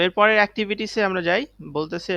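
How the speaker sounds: ordinary talk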